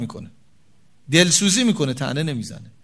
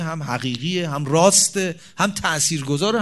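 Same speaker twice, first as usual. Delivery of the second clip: normal talking voice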